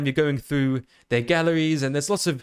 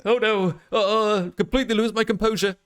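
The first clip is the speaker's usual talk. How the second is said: mock scared; 4 semitones higher than usual. VTuber demonstrating the scared expression for viewers, mock scared. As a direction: play at losing composure in fright. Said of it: "if I get really scared".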